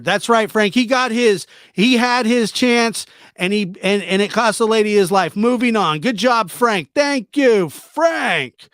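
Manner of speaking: triumphant cheering of a buddy; excited praise. Real, not acted. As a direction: celebrate the win, Frank did it. Celebrating Frank's success.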